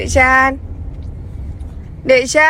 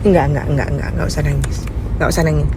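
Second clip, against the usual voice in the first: soothing; 7 semitones lower, 4 dB quieter, much faster than usual. Real, not acted. Mother comforting crying son.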